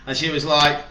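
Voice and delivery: ordinary delivery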